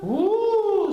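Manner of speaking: loud high woo; high ghostly whoop. A narrator's reading. Spooky moan in the dark, high and loud. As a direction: howl it like a ghost.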